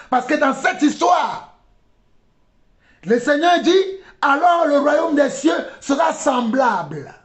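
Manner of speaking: insistent sermon delivery; impassioned preaching. Real, not acted. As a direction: proclaim it to the whole congregation. Preaches at full force.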